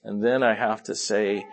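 ordinary delivery

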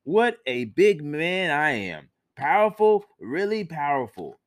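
swagger through it like a show-off; boastful Viho. Acted. Viho brags to himself about his power.

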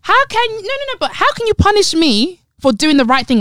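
high-pitched, retold outrage; indignant protest. Co-host retelling a parking fine dispute, indignant. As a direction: protest the unfairness, pitched well up.

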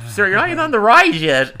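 very high, loud cracking squeak; hammed-up adolescent squawk with a laugh; squeaky-voiced teen. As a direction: squeak it out like an awkward teenager. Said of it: "the squeaky voiced teen".

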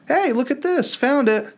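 high, breezy "found it" voice; mock casual discovery. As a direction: toss it off like a lucky find.

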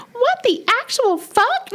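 imitating a slow shrill high-pitched yelp; high shrill curse again. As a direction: draw out the shocked squeal. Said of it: "some passion behind it".

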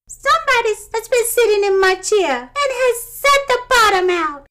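high, loud tiny bear squeal; little wee bear voice. A narrator's reading. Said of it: "in his little small wee voice".

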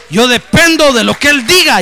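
high, loud declaration of faith; fervent shout. Acted. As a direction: proclaim it at the top of your voice. Preacher declares faith to the doctor. (grito fervoroso de fe)

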